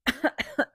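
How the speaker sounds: little pretend coughing fit; acted cough. Rooster tries waking the hens, pretend coughing. Rooster's sore throat in story.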